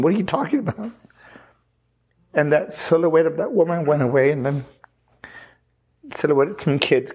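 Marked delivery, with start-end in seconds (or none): 0.0-0.9 higher-pitched, baffled retort; mild incredulity. Retold or imitated. Man answering the voice about a wife, mild incredulity. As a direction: retell a disbelieving question, pitch lifted without extra volume. Disbelieving reply to the voice.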